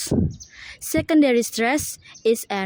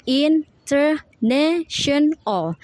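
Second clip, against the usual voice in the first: "international" slow, higher, every syllable apart; 5 semitones higher, slower than usual. Shown as a demonstration. Student showing the syllables, "international" slow and separated. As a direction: break "international" into in, ter, na, tion, al. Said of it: "is the syllables".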